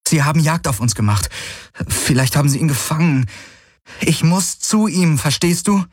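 put-on high, pleading young woman; urgent plea. A narrator's reading. Kirai begs the rider to take her back, high and urgent. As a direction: beg him to understand, voice pitched high.

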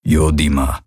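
deep alien rumble; low grumble. A narrator's reading. Hawks grumbles a warning to Kirai, deep and low. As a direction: growl the word low in the chest. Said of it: "sagte er".

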